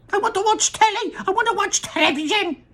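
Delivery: put-on very high, tiny boy's squeal; the shrunken boy demands to watch television; squeaky tantrum. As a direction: squeak it like an inch-tall boy throwing a fit. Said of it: "he squeaked".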